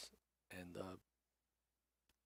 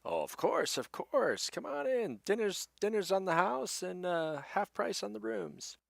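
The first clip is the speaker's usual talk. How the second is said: high, loud, welcoming host; friendly innkeeper; 11 semitones higher, 4 dB louder than usual. Acted. Innkeeper offers the heroes dinner on the house, friendly and high-pitched. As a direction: usher guests in with a raised, hearty voice. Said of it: "as friendly as ever".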